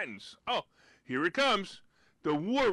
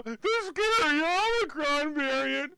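slow, high, louder spooky mockery; mock scary; 9 semitones higher, 5 dB louder than usual. Host mocking news hype, in a spooky mock tone. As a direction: ham up the scary variant. Mocking media fear of Omicron.

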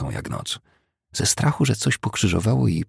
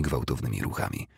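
ordinary delivery

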